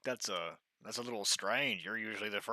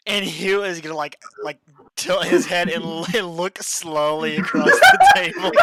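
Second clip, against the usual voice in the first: loud, high, breaking into laughter; laughing narration; 10 semitones higher, 21 dB louder than usual. Real, not acted. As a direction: narrate through a big laughing fit. Player laughs while narrating stunned reaction.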